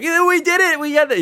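high, pumped-up cheer; imitating a thrilled coworker; excited. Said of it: "being like".

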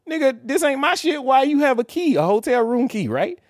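high, loud confronting; retold confronting the girlfriend; heated accusation. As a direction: demand an answer, indignant. Accusing girlfriend over key card.

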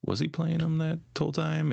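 high, startled realization; stunned realization. Retold or imitated. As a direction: ask it like the penny just dropped. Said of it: "you're like".

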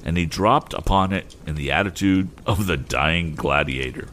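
ordinary delivery, nothing marked

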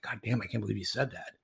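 retold quiet remark. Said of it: "And Joe's like".

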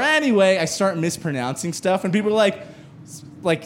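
ordinary talk, nothing marked

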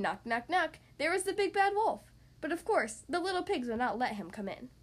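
plain reading voice

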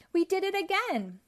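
high, bright cartoon hero voice; triumphant Pac-Man; Pac-Man's cheery voice. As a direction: crow it like a proud little hero. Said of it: "said Pac-Man".

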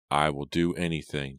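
usual speaking voice

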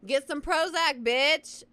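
loud, high scornful jeer; mocking jeer. Real, not acted. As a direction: shout your contempt at him. Mocking jailed cult leader.

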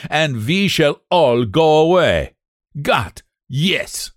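put-on blustering old German, suddenly kind; gruff German accent. Behrman's voice in the story.

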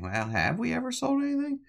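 way up high, squeaky and eager; squeaky boast. Retold or imitated. Stooge boasting to Doctor Brighto, in a squeaky high voice.

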